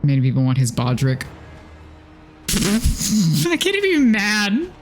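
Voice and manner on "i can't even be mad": high laughing disbelief. Real, not acted. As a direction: laugh it off, pitched way up. Laughs at chat's opinion.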